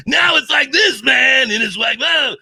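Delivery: very high, loud strained shout; blown-out Binky. Shown as a demonstration. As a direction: blow your voice out on every word. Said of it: "just completely blow out your voice".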